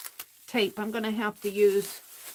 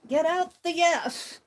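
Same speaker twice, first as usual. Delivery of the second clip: "Get out the Yes" repeated higher and louder; emphatic repeat; 8 semitones higher, 4 dB louder than usual. Real, not acted. Crafter cheerfully deciding on the Yes Paste, louder and higher. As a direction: declare it brightly to yourself. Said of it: "I'll get out the Yes".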